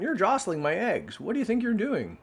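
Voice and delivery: high, scolding hen voice; indignant hen. Acted. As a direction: fuss at her like an annoyed hen. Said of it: "squawked the hen".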